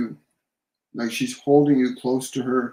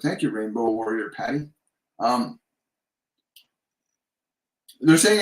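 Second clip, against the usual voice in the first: ordinary talk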